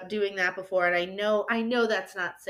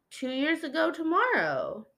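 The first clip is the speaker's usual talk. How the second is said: ordinary talk, nothing marked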